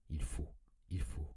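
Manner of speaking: whispered, as if driven by another will; compulsive whisper. A narrator's reading. Narrator whispering the invisible being's command, possessed whisper. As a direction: breathe it out, possessed and urgent.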